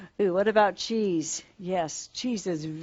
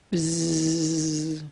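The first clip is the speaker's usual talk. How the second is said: bee buzz. Shown as a demonstration. Imitating a bee for children.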